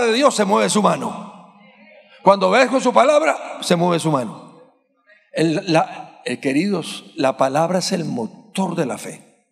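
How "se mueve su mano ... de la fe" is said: ordinary delivery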